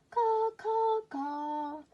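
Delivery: very high, loud caws; crow caws. Acted. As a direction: caw like a proud crow. Baby crow singing.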